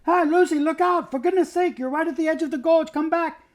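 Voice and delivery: high, loud call from far behind; alarmed shout of look out; shouting warning. Acted. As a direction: yell after her to come back. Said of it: "the voices of others shouting".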